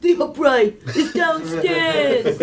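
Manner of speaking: a lightly voiced old grandpa. Imitating her grandpa for the hosts, slow mock old man. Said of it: "I'll do my grandpa".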